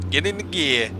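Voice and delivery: old cartoon gangster voice; cartoon mafia voice. Shown as a demonstration. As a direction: talk like a cartoon wise guy. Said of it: "I sound like the old cartoon mafia dudes".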